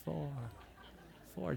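soft, drawn-out mocking chant; a quiet mock taunt from the pew. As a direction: tease him from the back row. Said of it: "just taunting me".

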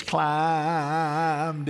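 slow preaching delivery; plain; slow, drawn out. Real, not acted. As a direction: stretch each word. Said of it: "I said".

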